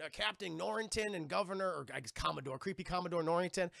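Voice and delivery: normal talking voice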